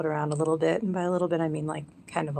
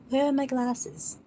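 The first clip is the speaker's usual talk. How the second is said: put-on British accent; 8 semitones higher, slower than usual. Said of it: "No, that's not it either".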